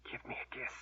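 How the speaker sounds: soft unvoiced whisper. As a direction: breathe it out with no voice. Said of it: "Warren whispered".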